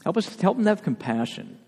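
fervent pastoral prayer rising over music; closing prayer. Acted. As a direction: pray it aloud for the whole church.

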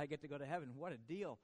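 ordinary talk

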